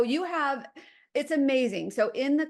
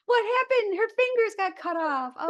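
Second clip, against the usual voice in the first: confused viewer; 9 semitones higher than usual. Retold or imitated. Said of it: "They're, they're".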